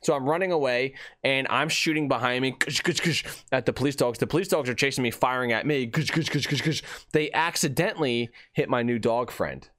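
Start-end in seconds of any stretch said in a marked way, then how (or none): 2.5-3.2 mouthed gunfire bursts; imitated gunshots. Storyteller shooting behind him at police dogs, imitated gunfire. 5.9-6.8 rapid run of mouthed gunfire; imitated gunshots. Police dogs firing at fleeing mailman, imitated gunfire.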